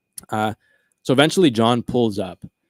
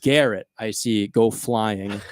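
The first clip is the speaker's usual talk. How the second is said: normal talking voice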